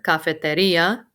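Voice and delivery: cafeteria said with the i stressed: cafete-RI-a. Shown as a demonstration. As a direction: hit the RI in cafeteria clearly. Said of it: "Your emphasis is on the I in this word".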